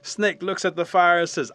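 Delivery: usual speaking voice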